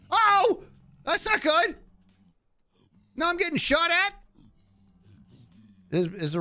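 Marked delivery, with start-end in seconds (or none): 0.1-4.1 sudden high alarmed yelp; a jolt of surprise, pitched way up; startled. Real, not acted. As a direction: caught off guard as the attack begins. Suddenly shot at by mobs.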